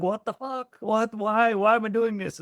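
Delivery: high, squeaky mock outrage; mock shocked disbelief. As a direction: play the client's stunned panic. Client's shocked reaction retold.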